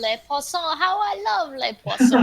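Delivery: put-on pretentious French accent. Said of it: "Everybody sounds so pretentious when they try to speak French".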